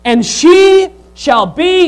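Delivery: loud, slow scripture proclamation; fiery preaching. Acted. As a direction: build the promise with rising fire. Reading scripture, preaching at full force.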